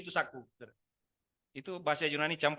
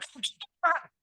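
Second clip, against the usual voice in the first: much higher, indignant demand; angry God; 7 dB louder, slower than usual. Acted. God rebuking a person who sues Him, indignant. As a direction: challenge the accuser hard. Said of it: "Lagi marah".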